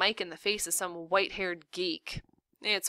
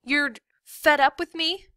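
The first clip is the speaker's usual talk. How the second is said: put-on high, loud disbelieving kid; affronted little girl; hurt, indignant daughter; 8 semitones higher, 7 dB louder than usual. A narrator's reading. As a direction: question mom in a child's raised voice.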